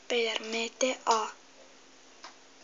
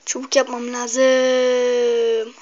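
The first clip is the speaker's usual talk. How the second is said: drawn out; 7 dB louder, much slower than usual. Real, not acted. Drawn-out slow statement.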